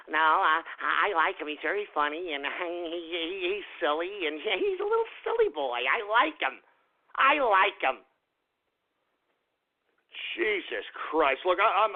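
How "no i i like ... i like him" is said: mock fan. Ranter mimicking everybody who likes the YouTuber, mocking fan voice. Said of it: "But no, everybody".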